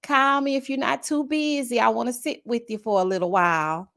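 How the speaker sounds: high, drawn-out hello opening, easing down; lightly voiced grandmother on voicemail; grandma's voicemail. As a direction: imitate grandma leaving a message. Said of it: "she'll leave a voicemail".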